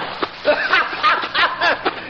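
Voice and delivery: high mocking cackle of triumph; high gloating cackle; gloating villain laugh. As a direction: laugh in triumph at their loss. Villain gloating over the drowned bag.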